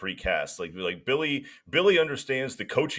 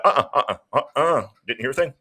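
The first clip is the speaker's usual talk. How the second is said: playing dumb coach; 4 semitones higher, 4 dB louder, faster than usual. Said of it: "Billy's just gonna be like".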